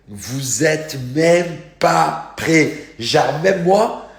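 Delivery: loud, high, words stretched right out; slow, emphatic build-up to a reveal; hyped-up amazement. Real, not acted. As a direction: hammer out each word in disbelief. Hyping an unbelievable lookalike photo.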